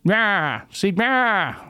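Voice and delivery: tossed-off old newsreel impression; old-timey twenties voice. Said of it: "my twentieth voice".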